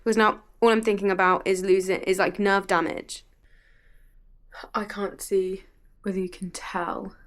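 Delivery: ordinary delivery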